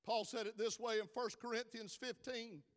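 loud, driving sermon cadence; preaching at full force. Acted. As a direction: preach it to the rafters.

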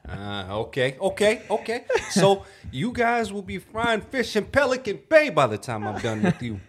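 high, loud, hammed-up movie threat; mock bluster, breaking into laughs; Denzel Washington. Co-host acting out a Training Day rant, hammed-up impression. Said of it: "Denzel impersonation".

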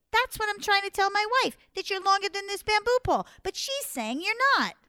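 high, loud, insistent complaint; feigned exasperation. Acted. Anansi feigns exasperation to python.

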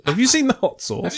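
lightly mocking rich American voice; posh rich son. Friend riffing on actor's rich upbringing, mock posh. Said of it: "how he usually asks his butler".

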